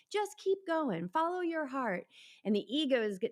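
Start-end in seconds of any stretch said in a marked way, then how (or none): 0.1-2.1 gentle inner wisdom. Pretend. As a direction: soothe yourself like a patient mentor. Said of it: "The one that's like".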